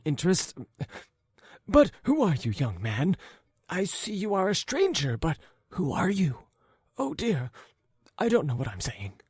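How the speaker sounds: put-on high, hurried, apologetic old voice; flustered old man. Old man fumbling to calm the young stranger, flustered. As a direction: babble out the apology.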